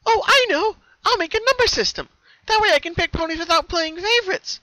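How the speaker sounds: very high, loud eager pony voice; scheming with delight; high gleeful plotting; bright idea. Acted. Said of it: "She smiled as she thought".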